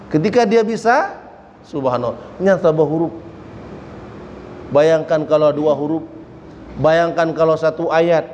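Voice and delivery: ordinary talk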